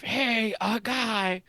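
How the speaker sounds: high, silly cry repeated; dopey cultist call. Retold or imitated. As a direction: recall the goon's high call. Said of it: "upon hearing the cry of".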